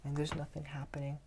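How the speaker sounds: barely audible, lifeless mumble; very quiet. Shown as a demonstration. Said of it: "I'm talking very quiet".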